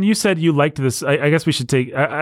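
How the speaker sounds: usual speaking voice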